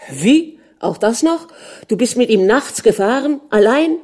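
high, loud incredulous questions; outraged questioning. Acted. Jealous husband questioning wife's night ride, outraged. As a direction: demand answers, rising in pitch.

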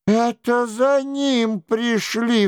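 high, drawn-out cry of alarm; exclaiming duchess. A narrator's reading. Duchess hears armed men at the gates, crying out. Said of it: "вскричала герцогиня".

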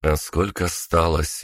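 ordinary delivery, nothing marked

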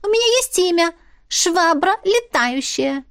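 high, slow squeaky creature; proud little animal, drawn out; squeaky otter voice. A narrator's reading. Otter character voice.

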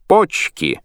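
high, loud cry of joy; shout. A narrator's reading. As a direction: yell it out. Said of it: "Кацман кричит".

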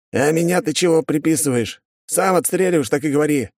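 high-pitched, defensive guard; disowning protest. A narrator's reading. Second guard refuses blame for the shooting, defensive. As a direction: protest your innocence to a mate. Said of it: "сразу открестился второй".

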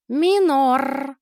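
high, loud anguished cry; wail. Acted. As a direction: yell the name out in alarm. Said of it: "завопил Мазарт".

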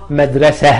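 مدرسة said madrasah, ending in H; final h, no t. Shown as a demonstration. Teacher proving it can be paused on, مدرسة ending in H. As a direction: drop the T and breathe an H ending on مدرسة. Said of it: "أقدر أنطقها".

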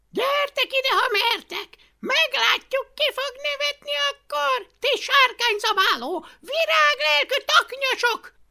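high, loud taunting challenge; furious shout. A narrator's reading. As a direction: yell the dare at the crowd. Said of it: "kiáltotta".

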